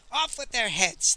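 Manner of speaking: high, loud bloodthirsty cry; howled. A narrator's reading. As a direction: howl it out like a mob. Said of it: "they howled".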